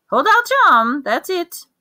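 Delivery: bright, eager instruction; brisk eager order. A narrator's reading. Wandmaker telling Harry to hold out his arm, eager and high.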